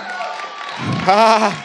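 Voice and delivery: loud, high "ah" of shock; freaked-out gasp. Retold or imitated. Man sees a healed back straighten, shocked cry. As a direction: gasp out loud, acting stunned. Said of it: "I'm, like, freaked out".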